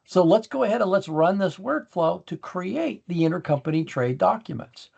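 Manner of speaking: ordinary delivery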